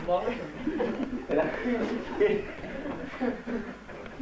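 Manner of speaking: a long, high laughing fit. Real, not acted. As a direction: laugh at the memory. Laughs at fence story.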